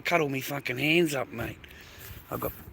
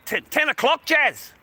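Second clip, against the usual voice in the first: much higher and louder call across the room; 13 semitones higher, 7 dB louder than usual. Real, not acted. Shouting the time over to Jazz, loud and high. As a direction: call her name from afar.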